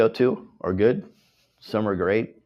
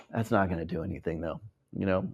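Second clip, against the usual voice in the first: ordinary talk, nothing marked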